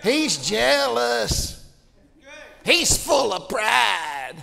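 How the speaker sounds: very slow, drawn-out mock accusation; mocking critics. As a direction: sneer it like a gossip.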